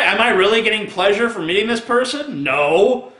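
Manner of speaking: ordinary delivery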